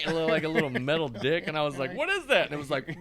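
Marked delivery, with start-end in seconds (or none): 2.0-2.5 high startled cry; surprised what is that. Retold or imitated. Retold shock at bidet.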